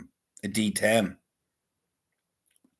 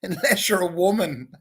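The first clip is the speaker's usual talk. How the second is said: laughing through the punchline; can't hold back a laugh on the words; giggling the bracket line out; 9 semitones higher than usual. Shown as a demonstration. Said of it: "And then underneath it, in brackets".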